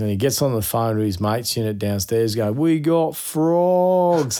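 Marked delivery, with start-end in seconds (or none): 2.5-4.4 high, very slow "We got frogs" stretched out; drawn-out excited cry. Retold or imitated. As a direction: crow it to the whole party. Teen phoning mate's unit.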